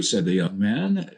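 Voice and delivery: ordinary delivery, nothing marked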